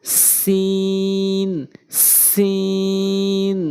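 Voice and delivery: সিন with a long hissing s; সিন pronounced slowly with a long s; drawn-out seen. Shown as a demonstration. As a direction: stretch সিন slowly, tongue tip at the teeth. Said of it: "জিহ্বার আগা উপরে নিচে দুই দাঁতের আগার সঙ্গে".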